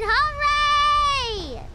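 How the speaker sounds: loud high hooray; excited cheer. Real, not acted. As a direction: cheer it out with joy. Cheering after boss.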